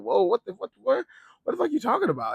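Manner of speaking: high-pitched, baffled outburst; mock shocked crowd. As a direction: act out everyone's stunned disbelief. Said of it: "everybody was like".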